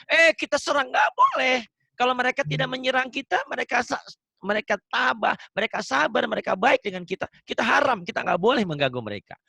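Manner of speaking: high, insistent preacher's admonition; emphatic preaching. Real, not acted. Ustadz forbidding attacks on peaceful neighbours, emphatic preaching.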